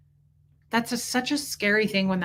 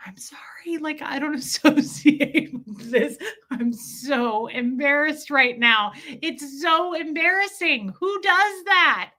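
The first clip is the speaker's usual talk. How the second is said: re-enacted embarrassed plea, stretched out and climbing higher; mortified apology; 5 semitones higher, slower than usual. Wife apologising to onlookers for her husband, acted mortified. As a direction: act out cringing apology, slow and drawn out.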